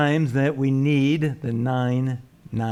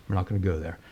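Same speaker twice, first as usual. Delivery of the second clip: We're not gonna go there said again, softer and trailing off; preacher echoing himself, quieter; quieter repeat; 7 semitones lower, 5 dB quieter, much faster than usual. Shown as a demonstration. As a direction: let the repeat fall away softly.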